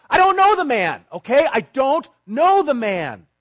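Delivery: high, loud, furious shout of denial; cursing shout. Acted. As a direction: scream the denial, cursing, slowing at the end. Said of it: "He calls down curses".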